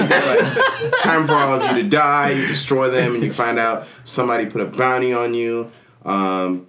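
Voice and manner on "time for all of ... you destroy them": ordinary talk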